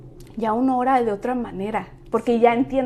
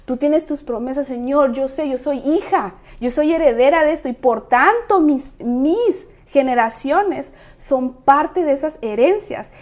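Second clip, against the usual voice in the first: oración; 7 dB louder than usual. Retold or imitated. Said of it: "entonces dices".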